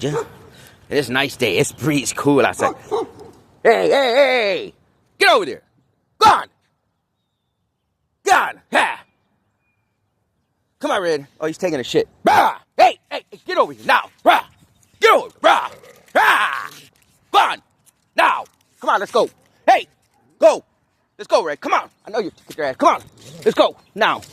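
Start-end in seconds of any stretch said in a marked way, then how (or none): 3.6-11.3 shouting across a field. Real, not acted. Calling dog Red outdoors. 12.2-18.4 high sharp yells at a dog; shouting across a field. Real, not acted. Yelling at the dog. 18.8-24.2 shouting across a field. Real, not acted. Urging the dog along.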